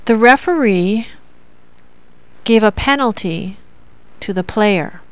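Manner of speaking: "The referee", "gave a penalty", "to the player" as separate groups; three thought groups. Shown as a demonstration. Teacher showing learners three thought groups, pausing after "referee" and "penalty". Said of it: "has three thought groups".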